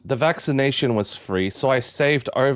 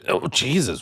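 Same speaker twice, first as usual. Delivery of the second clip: usual speaking voice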